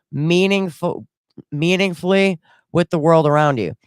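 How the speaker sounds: measured, read-aloud explainer voice; reading a mindfulness passage. Acted. Vlogger reading a definition to her viewers, in a read-aloud tone. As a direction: recite it like a wellness script.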